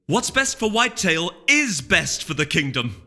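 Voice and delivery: slow, loud, high insistence; insistent. Acted. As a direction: press each word home. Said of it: "insisted Celerity".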